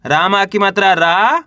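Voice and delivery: slow, रा held with a rising tune; spelling aloud. Shown as a demonstration. Teacher spelling a word for learners, slow with stretched रा. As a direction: spell it out and lift the final रा. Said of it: "एक बार फिर से देखिए".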